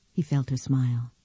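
plain reading voice

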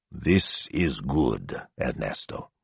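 low, slow, soft grunt; gruff grunt. Acted. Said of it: "Signor Maestro grunted".

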